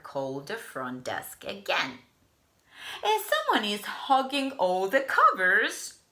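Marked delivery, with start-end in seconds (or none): 3.0-6.0 higher, louder irritated complaint; complaining guest. Acted. Mister Snore phoning about the pig, irritated. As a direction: complain hard.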